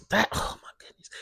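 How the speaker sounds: fast, breathless whisper. Real, not acted. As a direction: hush it as if still shaken. Shaken memory of the dream.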